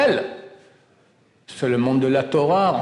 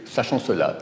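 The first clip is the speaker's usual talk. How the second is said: usual speaking voice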